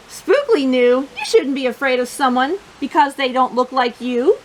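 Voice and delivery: plain reading voice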